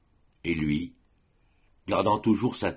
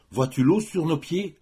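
put-on much higher, anxious girl's voice; acted alarm, pitched up; alarmed question; 10 semitones higher, 3 dB louder than usual. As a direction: ask it high and urgent, water rising. Girl points to the rising water.

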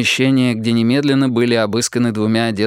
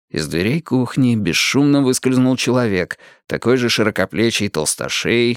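ordinary delivery, nothing marked

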